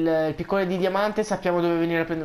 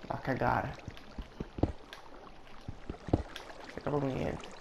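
1 borbottio sottovoce; 3 semitones lower, 12 dB quieter than usual. Real, not acted. Borbotta tra sé.

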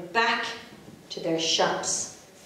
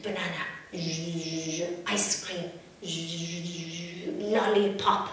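whisper; 3 semitones lower, 5 dB quieter than usual. Acted. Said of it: "they had a little whisper".

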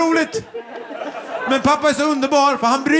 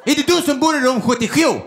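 indignant receptionist in broad Gotland dialect; Gotland accent; 3 semitones higher than usual. Retold or imitated. Receptionist scolds hotel guest, offended Gotland accent. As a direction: stretch it out in mock Gotland accent.

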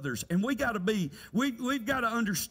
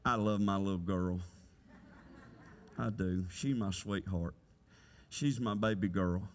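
much lower, softened fatherly tenderness; tender; 14 semitones lower, 5 dB quieter than usual. Real, not acted. Father tells church he loves his little girl, tenderly. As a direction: drop low and speak fondly. Father professing love for daughter.

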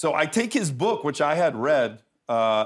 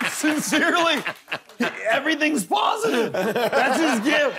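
excited gush; 11 semitones higher than usual. Real, not acted. Gushing about Arnold's positivity.

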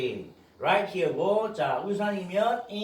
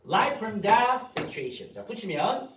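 normal talking voice

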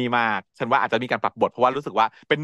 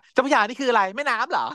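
naive confused heroine; 10 semitones higher than usual. Retold or imitated. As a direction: play her as innocently baffled. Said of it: "นางบอกว่า".

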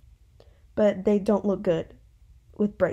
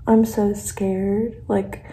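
ordinary talk

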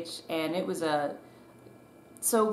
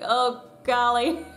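slow laughing gasp of nerves; mock-panicked, laughing; laughing oh golly; 6 semitones higher, 5 dB louder, slower than usual. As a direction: laugh out the racing heart. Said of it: "my heart's like".

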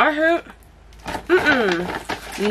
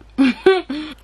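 laughing; 8 semitones higher, 4 dB louder than usual. Real, not acted. Laughs at own impression.

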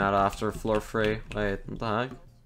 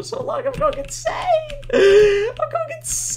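squeaky insane voice; 3 dB louder than usual. Pretend. Squeaky mock breakdown voice.

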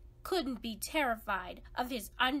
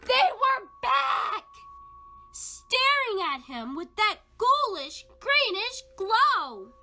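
dramatic reveal; 10 semitones higher, 10 dB louder than usual. A narrator's reading. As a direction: land the spooky return like a jump scare. Narrator's scary reveal.

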